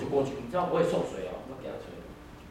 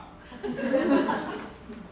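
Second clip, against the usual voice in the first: high laugh. Real, not acted. Laughing at pastor's retort.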